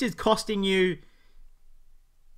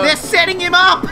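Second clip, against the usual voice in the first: words through a laugh; laughing while talking; 8 semitones higher, 7 dB louder than usual. Real, not acted. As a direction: crack up mid-sentence. Laughing at the prank.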